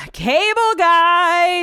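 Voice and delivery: loud, stretched-out mock announcement; slow, hammy emphasis; drawn-out exaggeration. Real, not acted.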